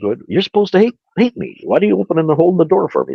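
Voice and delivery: high, fast, baffled questions; the prosecutor can't believe the courtesy; baffled disbelief. Retold or imitated. Prosecutor baffled at the door being held, high fast disbelief.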